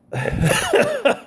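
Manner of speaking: sudden cough breaking into a laugh. Real, not acted. The speaker interrupted mid-story by a cough. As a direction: hack out a cough, then laugh. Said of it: "not covid".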